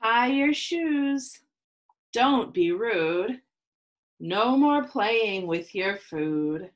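measured rhyming read-aloud with pauses; reading to children. Acted. Teacher reading a picture book to her class, storytelling. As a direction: read each rule out to the kids, lingering.

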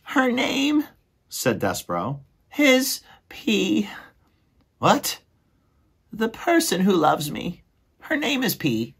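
very high, slow, dreamy squeak; lovestruck little mouse. Acted. Despereaux asking his brother about love, very high and dreamy. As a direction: float the question like he's in a daze.

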